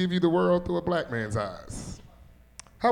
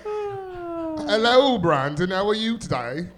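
a laughing, hammed-up Idris Elba hello; Idris Elba impression; 9 semitones higher, much slower than usual. Comic repeating his Idris Elba bit, laughing. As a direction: greet Brandon as a mock Idris Elba. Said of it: "that's my Idris Elba impersonation".

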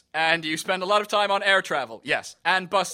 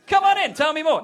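higher, louder mock invitation; hammed-up enthusiasm; mock eager welcome; 5 semitones higher, 8 dB louder than usual. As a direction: act out an over-keen host welcoming a caller in. Eager householder welcoming Jehovah's Witness.

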